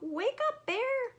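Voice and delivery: high call to wake Bear. Acted. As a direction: sing it out to a sleeper. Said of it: "Hare called out".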